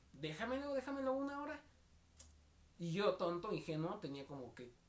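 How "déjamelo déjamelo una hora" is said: pitched up, lightly pleading; mock coaxing girl. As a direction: wheedle for the phone. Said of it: "Me decía". (novia pidiendo el teléfono, voz aguda insistente)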